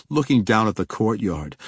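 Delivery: ordinary delivery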